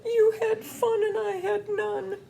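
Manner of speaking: high boo-hoo wailing bear; hammed-up sobbing bear; sobbing high, half laughing; mock crying. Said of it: "he's crying".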